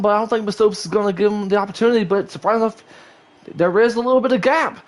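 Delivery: high-pitched rapid-fire race call; auctioneer. Real, not acted. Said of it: "sound like an auctioneer".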